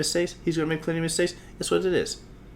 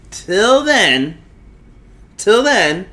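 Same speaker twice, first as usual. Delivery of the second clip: loud, high, swooping words; emphatic sign-off; 8 semitones higher, 8 dB louder, much slower than usual. Real, not acted. Host launching the end of the show, emphatically. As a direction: punch each one upward as a send-off.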